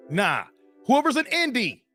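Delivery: ordinary talk, nothing marked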